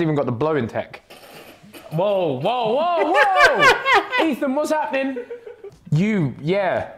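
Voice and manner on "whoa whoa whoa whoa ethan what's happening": rising from usual pitch to a high yelp; startled. Real, not acted. Man reacting to makeup technique, startled and amused. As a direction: whoas climbing higher and louder into laughter. Reacts in alarm while laughing.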